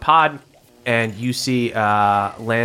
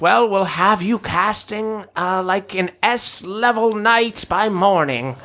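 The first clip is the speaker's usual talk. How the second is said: high, slow scientist voice; gleeful mad scientist; mad doctor voice; 7 semitones higher, slower than usual. Acted.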